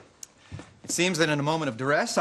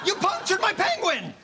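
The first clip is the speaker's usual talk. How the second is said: outraged shriek. Real, not acted. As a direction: shriek in horror. Outraged at ruined toy.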